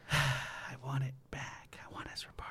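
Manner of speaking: playfully forlorn whisper; mock-wistful whisper. Wants lost username back, mock longing.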